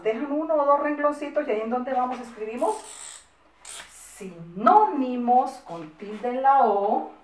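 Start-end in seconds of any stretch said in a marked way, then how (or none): 4.2-5.5 "sinónimos" drawn out, loud and clear; slow dictation. Shown as a demonstration. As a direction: dictate it syllable by syllable for children writing. Teacher dictating title slowly. (dictado lento y marcado)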